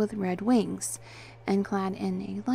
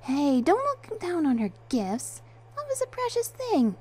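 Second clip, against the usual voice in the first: objecting; 10 semitones higher, 3 dB louder than usual. A narrator's reading. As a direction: object in a very pitched-up voice. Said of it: "Penny objected".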